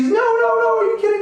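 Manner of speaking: very high, loud frantic No no no; frantic high-pitched panic; panicked cries. Retold or imitated. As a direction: shriek in disbelief at the lost ring. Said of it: "And she's".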